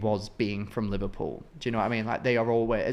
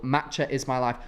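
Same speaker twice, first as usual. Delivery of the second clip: normal talking voice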